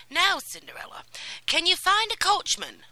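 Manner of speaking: high, loud, eager commands; excited fairy calling for the mousetrap; urgent fairy godmother. Acted. Godmother asks.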